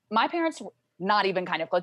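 normal talking voice

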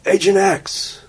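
yelling a name. Retold or imitated. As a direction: shout his name to catch him. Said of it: "So I yell".

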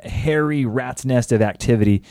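ordinary talk, nothing marked

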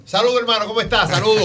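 high, loud greeting in firm Spanish. Retold or imitated. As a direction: greet him hard in Spanish so he knows. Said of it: "En español duro". (saludo en español duro, alto y fuerte)

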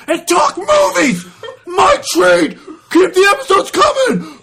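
cheerful read that grows into yelling; excited reading building to shout. Pretend. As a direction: start bright, end shouting with glee. Reading listener review aloud.

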